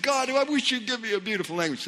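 high-pitched imitated plea; mock pleading. New believer asking God for nicer tongues, high mock plea. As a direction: lift the voice in a wishful mock prayer. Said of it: "I said".

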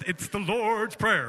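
slow, drawn-out churchy delivery; exaggerated church-style solemnity; churchy recital. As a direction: stretch LORD'S like a pious recitation. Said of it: "I'm not mocking it".

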